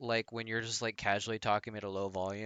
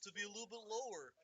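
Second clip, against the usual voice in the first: high, soft cartoon voice; Goofy voice; 10 semitones higher, 11 dB quieter than usual. Shown as a demonstration. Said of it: "And I'll do Goofy".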